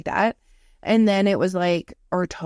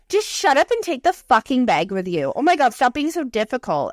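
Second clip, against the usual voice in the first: mock exasperated telling-off, raised pitch; exasperated scolding; 4 semitones higher than usual. Co-host insisting a friend take a bag, mock exasperated. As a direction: imitate someone fed up and bossing a friend. Co-host's scolding voiced in story.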